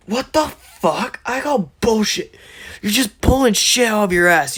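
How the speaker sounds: high, hammed-up angry disbelief; indignant outburst. Bakugo rejects Deku's explanation, indignant high outburst.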